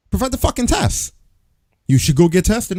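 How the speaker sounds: exasperated outburst; fed-up rant voice; angry. Real, not acted.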